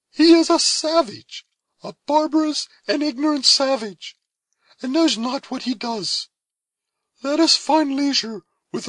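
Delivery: high, loud, noble and steady; resolute young woman. A narrator's reading. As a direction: speak with dignity in the face of death. Cora's lines.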